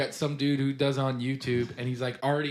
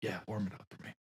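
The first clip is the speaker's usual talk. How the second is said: low mock craving; 7 semitones lower, 12 dB quieter, faster than usual. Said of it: "I'm like".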